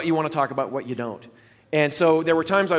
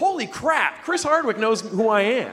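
higher, excited inner voice; thrilled disbelief; 6 semitones higher than usual. Retold or imitated. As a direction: gush like a starstruck fan. Retold thrilled reaction to tweet.

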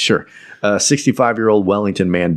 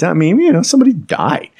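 high-pitched; 11 semitones higher, 3 dB louder than usual. Real, not acted. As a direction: voice jumps much higher. Mock solemn aside.

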